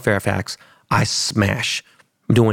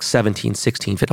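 ordinary talk

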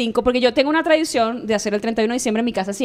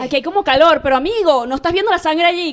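raised, louder pleading with a film character; urgent warning; 5 semitones higher, 4 dB louder than usual. Acted. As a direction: warn the victim as if through the screen. Acts out yelling at victim on screen.

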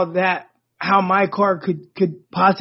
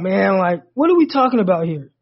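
usual speaking voice

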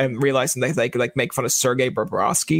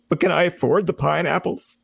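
higher-pitched comic worry; mock fretting; 4 semitones higher than usual. Broke job seeker fretting over pineapples on pizza, mock fretting. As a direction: play up the penny-counting dilemma.

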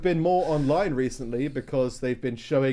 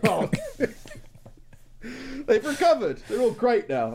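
higher, laughing mock agreement; sarcastic; 8 semitones higher than usual. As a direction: play along with mock relief. Sarcastic echo of Chile claim.

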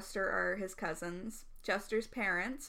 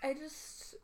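whisper; 4 semitones higher, 7 dB quieter than usual. Real, not acted. Sudden whisper.

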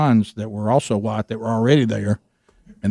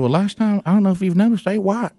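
imitating Gary, pitched higher; Gary's voice; 7 semitones higher than usual. Retelling what Gary said to Rick, higher imitated voice. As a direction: put on the friend's higher twang.

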